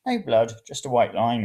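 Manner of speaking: ordinary delivery, nothing marked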